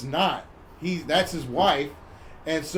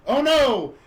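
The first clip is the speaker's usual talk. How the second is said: higher exclamation of horror; mock dismay; 6 semitones higher, 4 dB louder than usual. Storyteller reacting as the spiders are found, mock dismay. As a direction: play up the shock theatrically.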